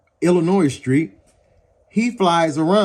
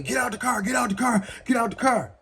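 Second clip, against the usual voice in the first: high, repeated mock yell at the car; retold cop yelling get out the car; mock shouting cop; 7 semitones higher, 4 dB quieter, faster than usual. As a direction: bark the order over and over, pitched up. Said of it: "He's screaming".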